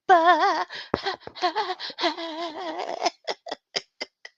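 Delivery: long high giggle; high laughter after the mock line. Real, not acted. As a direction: crack up at your own joke. Laughing at own joke.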